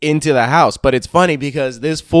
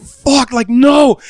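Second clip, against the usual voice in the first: higher-pitched, alarmed disbelief; retold alarm; 8 semitones higher than usual. Friend reacting to a bleeding buddy, retold alarm. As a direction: replay the panic with pitch jumping up.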